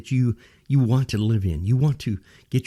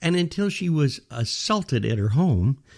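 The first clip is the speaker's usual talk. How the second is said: ordinary talk, nothing marked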